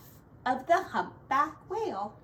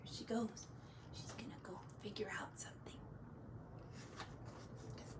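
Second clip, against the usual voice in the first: whisper; 3 semitones lower, 21 dB quieter, much faster than usual. Real, not acted. Aside to child, hushed.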